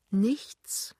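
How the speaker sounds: small, whispered answer. A narrator's reading. Wife answers her annoyed husband, whispered. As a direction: answer his outburst in a mere whisper.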